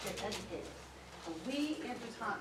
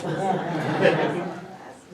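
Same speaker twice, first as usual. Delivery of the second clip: laughing; 4 semitones lower, 12 dB louder than usual. Real, not acted. Host laughing at long distances.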